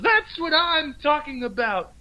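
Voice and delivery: high, slow, drawn-out scolding; mock outraged Cosby, stretching out every word. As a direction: lecture each word slowly in a high put-on voice. Cosby voice again.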